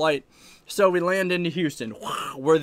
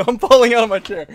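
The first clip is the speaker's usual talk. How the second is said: laughing loudly while falling; real laughter; 6 semitones higher, 8 dB louder, faster than usual. Real, not acted. Streamer falling off his chair, laughing loudly. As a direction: laugh through the words as the chair tips.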